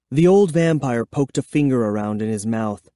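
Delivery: ordinary delivery, nothing marked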